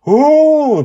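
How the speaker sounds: high, loud thrilled ooh; a girl spotting a wonder; delighted child gasp. Acted. Joy leans over and squeals at the stairs.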